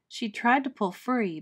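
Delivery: ordinary delivery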